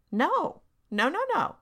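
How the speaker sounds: high, loud repeated no; emphatic no. Shown as a demonstration. Parent rejecting cookie bribe, emphatic loud no. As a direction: shut the idea down hard.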